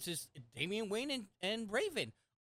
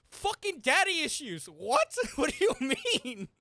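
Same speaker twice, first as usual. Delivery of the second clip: loud, high exasperated yell; heated outburst; 8 semitones higher, 8 dB louder than usual. Real, not acted. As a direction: blurt it out in disbelief. Heated argument about fandom.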